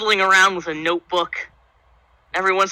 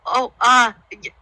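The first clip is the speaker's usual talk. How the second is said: higher, stammering fluster; startled barmaid; 5 semitones higher than usual. Acted. Barmaid looking up at a huge half-orc, startled stammer. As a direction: stumble over the words, taken aback. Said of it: "a full two feet taller than she is".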